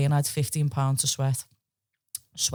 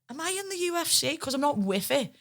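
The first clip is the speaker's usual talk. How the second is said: woozy confused voice, much higher; dazed high question; 11 semitones higher, 3 dB quieter than usual. Retold or imitated. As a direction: play just waking from a knockout. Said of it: "I'm like".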